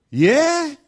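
high, loud questioning Alabama voice; mock Alabama accent. Driver puzzled by London, high and loud Alabama accent. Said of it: "This accent is a Vaughnabama accent".

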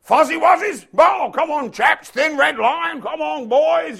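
high, loud, rallying officer cry; mock British officer rallying the troops; a stiff-upper-lip officer shouting to his men; hammed-up British officer. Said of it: "We invented that with the Fuzzy Wuzzies".

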